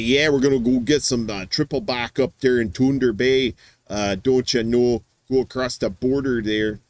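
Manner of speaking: put-on a light Minnesota accent on an upbeat trip plan; a hint of Minnesota, easy and chatty. Playing a Duluth local with a light Minnesota accent. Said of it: "Minnesota type".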